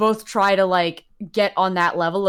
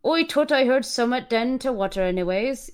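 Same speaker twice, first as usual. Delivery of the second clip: higher, thick country dialect; thick, oily accent; 6 semitones higher than usual. Acted. Reader voicing a man heard by the water, thick oily accent. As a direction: lay on an oily rural accent. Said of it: "his accent so thick and oily you could almost taste it".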